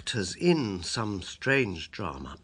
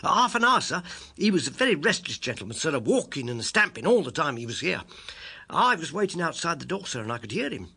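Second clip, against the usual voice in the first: put-on high, loud, hurried servant boy; page boy; 6 semitones higher, 4 dB louder than usual. Page boy tells Holmes about the restless visitor, high and hurried. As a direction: rattle it off like an excited page.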